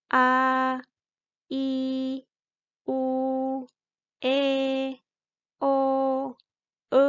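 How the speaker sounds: each of "a, i, u, e, o, e" held high and level; first tone. Shown as a demonstration. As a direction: say "a, i, u, e, o, e" as long straight notes, pausing between. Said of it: "jadi bacanya panjang".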